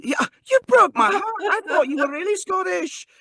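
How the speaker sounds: very high, hammed-up Scottish wail; heartbroken Scottish mom. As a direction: wail betrayal as a Scottish mother. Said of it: "She smacks me".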